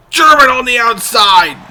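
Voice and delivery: loud, slow hype shout; shouted call. Real, not acted. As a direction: yell it like the crowd erupts. Big spot called live.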